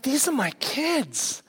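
dad stunned by what he hears; incredulous. Retold or imitated. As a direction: say it in mock amazement. Dad's disbelief at praise.

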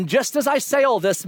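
ordinary talk

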